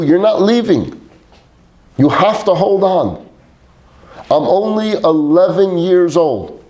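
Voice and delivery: begging a dying father to stay, pitch climbing; desperate pleading cry. Retold or imitated. Boy begging his dying father not to leave, high desperate plea. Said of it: "And I just yelled out".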